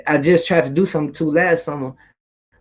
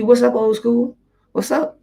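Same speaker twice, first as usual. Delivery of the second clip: friendly greeting; 8 semitones higher, slower than usual. Retold or imitated.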